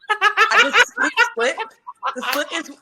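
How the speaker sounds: high, loud giggling burst; embarrassed giggle; laughing. Real, not acted. Laughing embarrassed about her dress.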